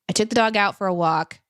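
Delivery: ordinary delivery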